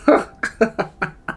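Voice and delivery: short burst of laughter; real laugh tailing off. Real, not acted. Laughs about pranksters.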